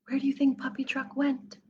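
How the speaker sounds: ordinary delivery, nothing marked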